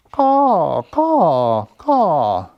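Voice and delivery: high, loud cawing crow. Acted. The crow answers the bear with a loud high caw.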